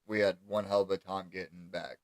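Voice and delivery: usual speaking voice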